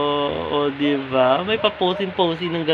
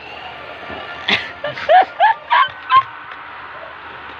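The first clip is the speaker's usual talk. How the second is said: high squealing laugh. Real, not acted. Laughing at zombie pose.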